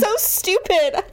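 high teary voice half laughing; crying and laughing at yourself; tearful laughing. Real, not acted. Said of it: "you all got to hear me cry".

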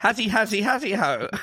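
high sing-song fox call. Pretend. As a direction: chant it like a fox.

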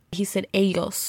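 normal talking voice

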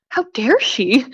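indignant how dare she, the word dare leaned on; half-joking shock at the coworker's nerve, dare stressed; mock outrage. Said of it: "I remember thinking".

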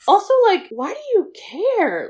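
high, loud exasperated question; indignant. Real, not acted. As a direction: demand why they even care. Indignant about staring.

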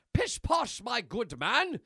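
high, loud mock-haughty dismissal; hammed-up gentlemanly bluster; Milk jumping in. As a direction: wave the officer off grandly. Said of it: "And Milk jumps in".

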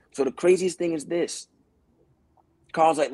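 ordinary talk

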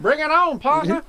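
put-on a cowboy Southern accent throwing down a challenge. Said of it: "you do a good, like, redneck accent".

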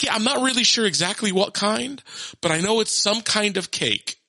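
put-on high-pitched kid explaining; earnest young daughter. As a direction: imitate a child sure of a half-known fact. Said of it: "She's like".

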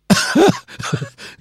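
high, loud cackle of laughter; shrieking laugh. Real, not acted. Laughing hard at her friend's huge bill.

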